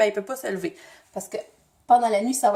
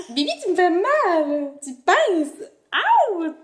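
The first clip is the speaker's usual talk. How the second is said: real pained protest; 6 semitones higher, much slower than usual. Real, not acted. Maman pinched by Bibi's little fingers, pained protest. Bébé la pince, elle se plaint.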